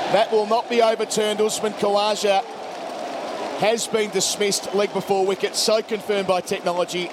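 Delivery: rising live call, excited at wickets; cricket commentator. Real, not acted. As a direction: call each ball as it happens.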